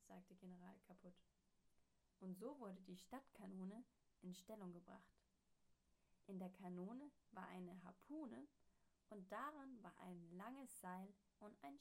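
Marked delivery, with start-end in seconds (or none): none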